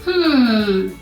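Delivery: usual speaking voice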